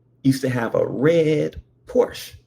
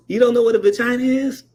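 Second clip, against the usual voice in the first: put-on higher, faster, louder kid voice; mocking kid; 9 semitones higher, 3 dB louder, faster than usual. Timmy teasing the boys, higher fast mocking kid. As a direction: tease like a smug little boy. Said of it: "And he goes".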